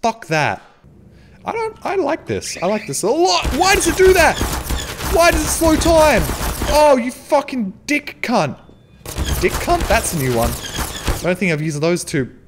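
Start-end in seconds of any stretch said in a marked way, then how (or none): 3.5-6.2 high, loud outraged questions; indignant yelling. Real, not acted. Frustrated by game slowdown. 6.7-8.6 slow, loud and high furious swearing; angry cursing. Real, not acted. Cursing at the game.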